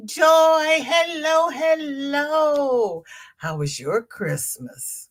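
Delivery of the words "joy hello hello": greeting Joy in the chat with a sing-song hello. Real, not acted. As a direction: sing out a welcome. Greeting a new viewer.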